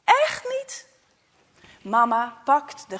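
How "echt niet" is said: much higher, louder, desperate cry; a boy insisting, much higher and louder. Acted. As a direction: shout the denial close to tears. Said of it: "Hij schreeuwt het uit".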